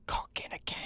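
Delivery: breathy whispered slogan; whispered tagline. Acted. A streamer ending his fake advert, whispered slogan.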